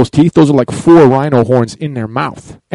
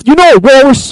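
loud, high-pitched burst; excited near-scream; 9 semitones higher, 6 dB louder than usual. Real, not acted. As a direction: raise your voice, carried away by the question. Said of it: "Stop screaming".

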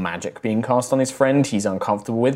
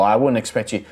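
ordinary delivery

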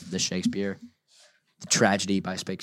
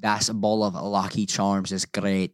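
slow put-on Scottish accent; careful amateur Scottish accent. Shown as a demonstration. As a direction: say it like a Scot, deliberately. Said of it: "I can say anything in a Scottish accent".

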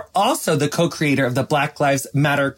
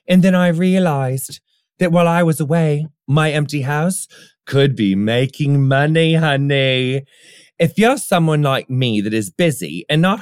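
hammy posh British ad voice; British accent. Pretend. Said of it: "I was doing my British voice earlier".

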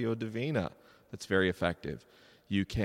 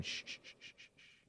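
whispered repeated "shh", fading away; soft shushing; 21 dB quieter than usual. Shown as a demonstration. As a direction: hush the room very softly. Hushing to demonstrate silence.

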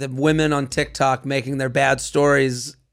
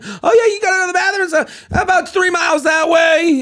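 high, loud mocking yell; mock shout; 13 semitones higher, 4 dB louder than usual. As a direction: shout it like a know-it-all. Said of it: "Everyone's like".

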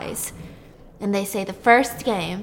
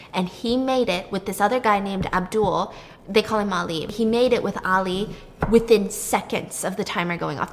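ordinary talk, nothing marked

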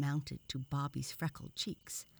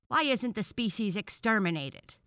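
loud, raised indignant demand; angry; 6 semitones higher, 9 dB louder than usual. A narrator's reading. Frog demands an end to the species, angrily. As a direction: burst out in outrage. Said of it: "asked another frog angrily".

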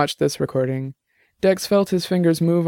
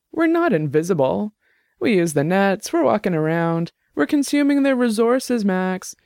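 higher-voiced Jenny, heated and arguing; argumentative tipsy woman; 6 semitones higher than usual. Tipsy Jenny arguing with her roommate's friend, heated. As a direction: push the point hard, a few drinks in.

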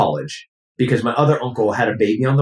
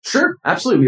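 much higher, eager yes; eager teen; faster than usual. Retold or imitated. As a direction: jump at the offer like a keen teenager. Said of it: "And I was like".

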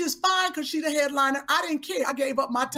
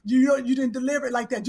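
usual speaking voice

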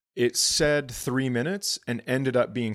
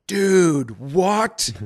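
loud, higher disbelieving dude, what; stunned dude what; 6 semitones higher, 8 dB louder than usual. Retold or imitated. To the driver who suddenly speaks English, loud disbelief. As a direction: blurt it out in total shock. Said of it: "And I go".

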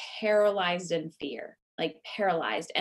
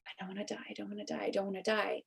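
fast, much softer, a touch of mock panic; hushed frantic plea; 10 dB quieter, much faster than usual. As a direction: rush it out under your breath. Crying internally, retold plea.

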